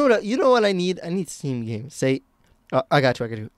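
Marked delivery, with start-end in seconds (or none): none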